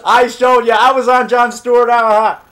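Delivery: high, loud triumphant boast; loud gloating. Real, not acted. Telling off doubter Bookwater, gloating loudly. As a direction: crow it out at the doubter.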